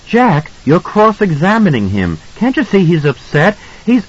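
high, urgent wife's voice; upset protest. A narrator's reading. Wife defends upset son to husband, high protest.

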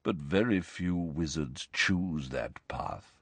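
low, slow, soft regret; heavy-hearted, sinking delivery; miserable. A narrator's reading. Ghost explains few choose his path, miserably. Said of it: "said Nick miserably".

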